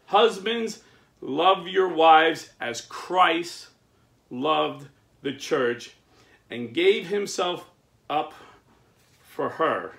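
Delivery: slow, loud solemn reading; scripture reading. Acted. Reading Paul's words to the church, slow and solemn. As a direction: proclaim each phrase like a preacher.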